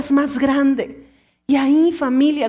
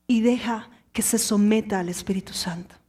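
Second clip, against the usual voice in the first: whisper; 5 semitones lower, 5 dB quieter than usual. Acted. Preacher to his congregation, hushed whisper. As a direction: drop to an intimate whisper.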